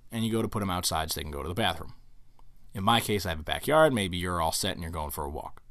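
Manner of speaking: ordinary delivery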